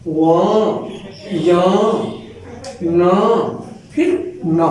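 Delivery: व, य, न, न sounded one by one through the nose; teacher sounding out the nasal letters; nasal sounds demo. Shown as a demonstration. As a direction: let each न ring through the nose. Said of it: "जैसे हम कहते हैं अंग".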